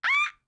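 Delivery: short, very high squeal of surprise; high-pitched happy yelp; delighted squeal. Real, not acted. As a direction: let out a tiny excited squeak. Text to speech suddenly works.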